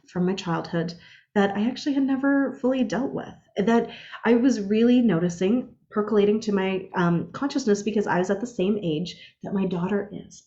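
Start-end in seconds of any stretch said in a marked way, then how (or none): none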